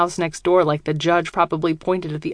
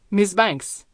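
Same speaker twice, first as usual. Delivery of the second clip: put-on thick German accent, deep voice; low, heavy German-accented speech; 2 semitones higher than usual. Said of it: "His accent is thick, German, and his voice is deep".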